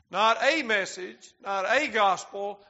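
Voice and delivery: "a" said "ay", drawn out each time; stress on A. Shown as a demonstration. Preacher rejecting other messages, stressing "a". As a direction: hit "a" twice to dismiss the alternatives. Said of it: "I'm emphasizing".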